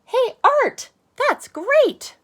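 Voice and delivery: put-on very high, loud cheerful kid voice; Max cheerful. Max compliments Arthur's portrait, very high cheerful voice. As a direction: chirp it out like an eager little lizard. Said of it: "And Max says".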